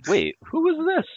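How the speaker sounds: very high, incredulous question; real surprise. Real, not acted. Bandmate hearing of the drummer's praise for the first time, surprised. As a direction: pitch shoots up, caught off guard.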